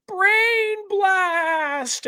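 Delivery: squeaky high cartoon boy voice; Jimmy Neutron voice. Podcaster imitating Jimmy Neutron's brain blast, high cartoon voice. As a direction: brainstorm shouted like a cartoon kid genius.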